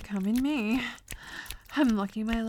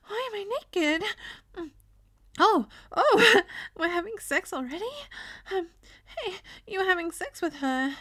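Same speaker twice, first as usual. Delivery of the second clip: higher-pitched, hesitant and apologetic; timid, sweet-natured character; 7 semitones higher than usual. Acted. Startled character speaking to partner, timid higher voice. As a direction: play the flustered, innocent one. Second character takes over.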